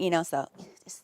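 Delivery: whisper. Real, not acted. Whispering a secret aside.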